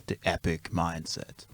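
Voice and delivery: low, dramatic trailer voiceover; a hint of an epic movie trailer. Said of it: "a movie trailer voiceover".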